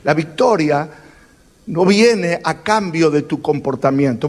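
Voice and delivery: ordinary talk, nothing marked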